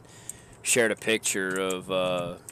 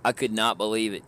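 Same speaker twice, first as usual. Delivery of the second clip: usual speaking voice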